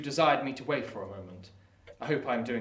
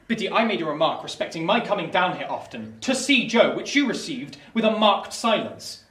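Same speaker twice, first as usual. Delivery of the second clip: indignant reproach; 4 semitones higher, 8 dB louder than usual. Acted. Pip reproaches Biddy's silence.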